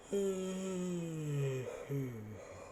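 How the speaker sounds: drawn-out falling hum; long high sigh. Real, not acted. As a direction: let out a long high note, sliding down.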